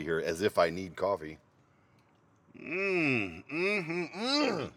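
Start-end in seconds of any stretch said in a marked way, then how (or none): 2.6-4.7 savoring hum. Real, not acted. Savoring a sip of coffee.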